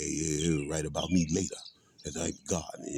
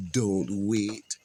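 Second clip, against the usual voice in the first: imitating [name]; 5 semitones higher, 5 dB louder, much slower than usual. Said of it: "[name]".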